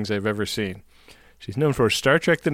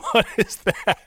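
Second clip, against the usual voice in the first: high, laughing disbelief; what on earth is that; incredulous; 10 semitones higher than usual. Real, not acted.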